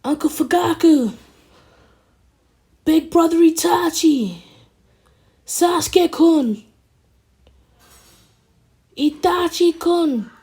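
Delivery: loud, high-pitched calls for missing people; high searching shout; calling out names. Acted. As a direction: shout each name out high, drawing out the end. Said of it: "he starts calling out names".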